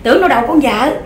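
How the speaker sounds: ordinary talk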